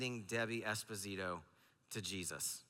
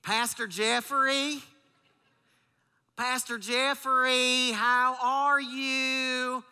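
high, slow, drawn-out Southern twang; high slow Southern woman on the phone; Debbie's Southern accent; 11 semitones higher, 8 dB louder, much slower than usual. Retold or imitated. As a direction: do Debbie's thick Southern drawl. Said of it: "let's see if I can do it".

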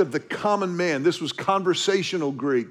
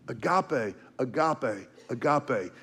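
softer, savoring Agape, agape, agape; soft repetition; 6 semitones lower, 7 dB quieter than usual. Real, not acted. Pastor dwells on agape, repeating it softly. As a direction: let the word agape linger quietly.

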